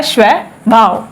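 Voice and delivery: स्वभाव said slow and careful, sv joined; correct pronunciation, slowly. Shown as a demonstration. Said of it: "क्या होता है?".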